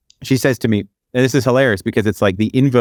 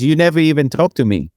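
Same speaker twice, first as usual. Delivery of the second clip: put-on higher-pitched, lightly Brazilian-accented woman; 6 semitones higher than usual. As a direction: imitate her complaint in a light Brazilian accent. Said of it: "with, with the accent".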